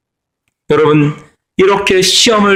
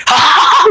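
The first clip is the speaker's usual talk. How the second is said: high, delighted burst of laughter; mock high laugh of a proud daughter; gleeful laugh; 13 semitones higher, slower than usual. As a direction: laugh in triumph. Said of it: "그러고 막 소리 지르고".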